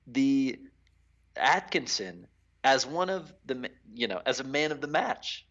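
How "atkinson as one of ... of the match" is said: normal talking voice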